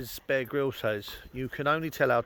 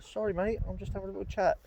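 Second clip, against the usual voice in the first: higher, softer doggy-talk aside; 6 semitones higher, 6 dB quieter, faster than usual. Real, not acted. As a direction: coo a quick apology to the pup. Talking aside to his dog.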